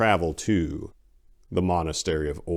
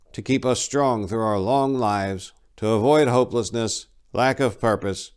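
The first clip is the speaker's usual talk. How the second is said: raised, solemn storytelling monk; old monk reciting; 5 semitones higher, 4 dB louder than usual. Acted. Old monk retelling the god's story to pass guard duty, solemn. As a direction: recite the creed like an old habit.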